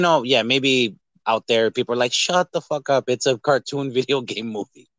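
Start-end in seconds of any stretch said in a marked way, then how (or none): none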